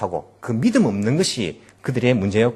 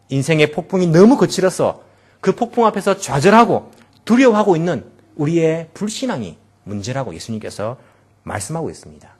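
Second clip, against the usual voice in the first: normal talking voice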